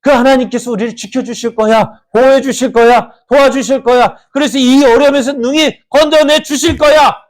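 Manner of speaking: high, eager voice of a trusting believer; rising, hopeful plea of the faithful; confident believer's prayer. Retold or imitated. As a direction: pray it out high and sure, full of faith. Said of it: "우리 같으면은".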